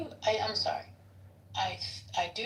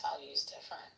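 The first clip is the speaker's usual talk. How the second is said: whisper; 4 semitones lower, 5 dB quieter than usual. Real, not acted. Deadpan aside.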